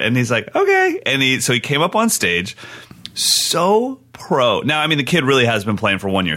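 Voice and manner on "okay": put-on much higher and louder, a tiny boy's answer; little kid voice. Eight-year-old answering the drummer, high child voice. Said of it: "in his little Harry Potter voice".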